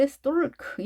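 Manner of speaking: "stalk" with the L pronounced; common misreading. Shown as a demonstration. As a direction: mispronounce "stalk" as "stol-k". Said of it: "이렇게 대부분 읽을지도 모르겠어요". ("stalk"를 "스톨크"로 잘못 읽기)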